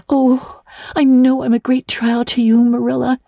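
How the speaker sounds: put-on high girl's voice, sorry and earnest; a young girl owning up to her faults; repentant girl Anne. A narrator's reading. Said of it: "said Anne repentantly".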